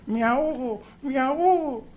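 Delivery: cartoon cat's high plea; drowning cat crying out. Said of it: "el gato empieza a gritar".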